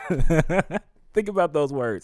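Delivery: loud, high, drawn-out with a laugh; moved, emphatic exclamation after a laugh; laughing emphasis. Real, not acted. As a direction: laugh in wonder, then press each word.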